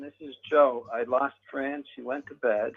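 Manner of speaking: usual speaking voice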